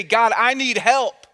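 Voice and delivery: insistent, slow plea; emphatic plea. Acted. Urges congregation to ask God.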